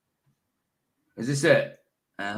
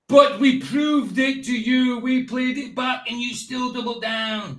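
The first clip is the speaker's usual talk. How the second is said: heated, slow, raised voice; angry shouting; 7 semitones higher, slower than usual. Real, not acted. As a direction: shout it back in anger. Heated argument with a troll.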